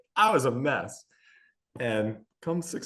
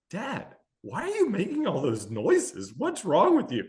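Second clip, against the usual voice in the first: put-on higher, a curious kid's voice; puzzled daughter; 8 semitones higher than usual. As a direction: ask like a puzzled child. Daughter puzzled by dad's noises.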